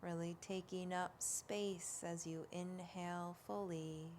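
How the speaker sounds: slow, hushed yoga relaxation voice; unhurried, even meditation delivery, words spaced out; guided meditation. Real, not acted.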